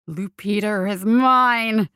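slow, snarled claim from a far-off menacing voice; snarling voice. A narrator's reading. A voice in Follop's head claims Lupita, slow snarl. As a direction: growl it like a possessive spirit in his head. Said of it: "a voice snarled in his head".

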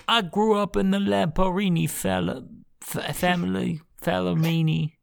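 hammed-up wiseguy; mock mafioso. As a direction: boast like a cartoon gangster, stumbling over the name. Improvised lamp mafioso introducing himself.

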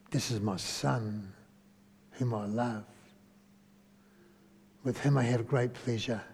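low, hushed and unhurried; tender fatherly quote. Acted. The Father speaking of his beloved son, tender. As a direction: speak softly, as a loving father. Voicing the Father's love for Jesus.

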